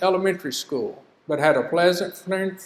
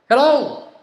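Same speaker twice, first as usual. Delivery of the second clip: bright, higher, louder hello with a smile; smiling hello; 8 semitones higher, 8 dB louder than usual. Shown as a demonstration. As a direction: greet them like you're glad they called. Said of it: "with a smile on".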